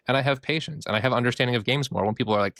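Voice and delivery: normal talking voice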